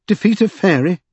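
high, aghast question; horrified exclamation. A narrator's reading. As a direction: recoil at the very idea. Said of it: "exclaimed Mister Secundus in horror".

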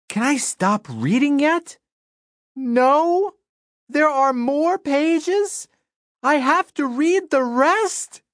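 exasperated pleading. Acted. Reader pleading in dismay.

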